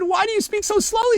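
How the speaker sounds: high-pitched, strained acted complaint; close to crying. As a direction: plead in a high, upset voice. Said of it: "afraid you're gonna start crying".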